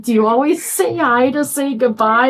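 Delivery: a hint of amused ribbing; teasing host.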